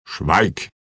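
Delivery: understated growled command; mock-indignant growl. A narrator's reading. Feuerlanze silencing the offended Dorang, mock-indignant growl. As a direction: silence them with feigned outrage, without raising the voice. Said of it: "knurrte Feuerlanze mit gespielter Entrüstung".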